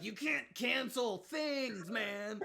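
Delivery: slow, drawn-out dude voice; dopey stoner voice. Retold or imitated. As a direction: mimic a lazy dude. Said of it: "And they're like".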